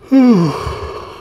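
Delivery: a long, high, tired yawn; big yawn. Real, not acted. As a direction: let a late-night yawn out. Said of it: "Oh, sorry about yawning".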